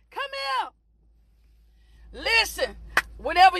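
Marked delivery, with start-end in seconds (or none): none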